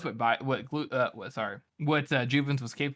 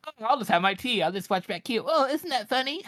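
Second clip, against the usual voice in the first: high, put-on dainty voice; hammed-up higher-pitched mockery; mock smugness; 8 semitones higher than usual. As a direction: mimic someone smugly amused. Mocking imitation of idle Baez.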